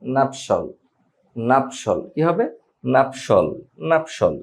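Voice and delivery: নাপশল as two syllables NAP-shol, no ti sound; correct nuptial. Shown as a demonstration. Teacher corrects students: নাপশল not নাপশিয়াল. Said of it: "এটার উচ্চারণ হবে just".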